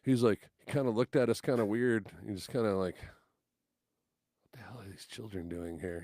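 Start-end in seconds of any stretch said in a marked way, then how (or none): 3.0-6.0 slow, whispered disbelief; puzzled whisper. Retold or imitated. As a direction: whisper it like a private thought. Singer's puzzled look given as muttered thought.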